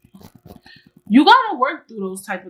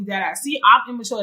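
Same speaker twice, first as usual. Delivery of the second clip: ordinary delivery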